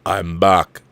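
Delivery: Terminator catchphrase, low start building to a drawn-out cry; lightly voiced Terminator, stretching the last words out big. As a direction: do the Terminator, slow and punchy. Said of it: "in my Terminator two voice".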